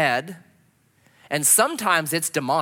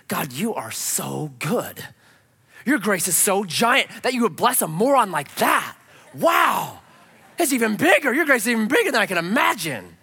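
amazed praise; 5 semitones higher, 5 dB louder than usual. Acted. Preacher acting out amazed prayer.

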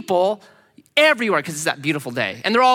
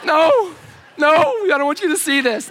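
panicked plea; 10 semitones higher, 4 dB louder than usual. Retold or imitated. Capsized, onlookers watching him.

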